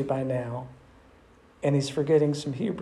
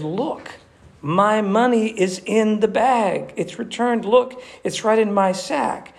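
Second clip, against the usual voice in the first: raised, urgent alarm; astonished discovery; 5 semitones higher, 3 dB louder than usual. Acted. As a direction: show the others what you just found. Brother discovers returned money in bag.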